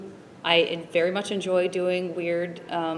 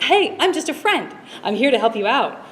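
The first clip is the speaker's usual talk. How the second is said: higher, louder fake-friendly phone bot; automated voice; 7 semitones higher, 6 dB louder than usual. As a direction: play the chirpy automated helpline. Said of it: "make fun of that".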